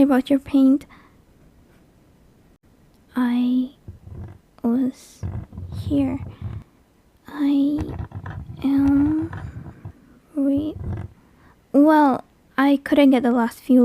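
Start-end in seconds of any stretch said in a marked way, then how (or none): 3.2-10.8 one word at a time, long gaps between; halting, word by word. Pretend. Demon carving a message into the drywall, halting word by word. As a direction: say each word as you scratch it out. Speaking words while carving them.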